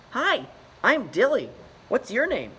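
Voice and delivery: put-on high, loud chirpy young voice; bright eager greeting; cheerful beluga. Trapped beluga Dilly greets Ichabod, high and chirpy.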